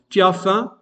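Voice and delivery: "tu as faim?" ending on a clear upward lift; rising question tune. Shown as a demonstration. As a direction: let "faim" rise like a spoken question. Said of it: "par l'intonation montante". (intonation montante sur « faim »)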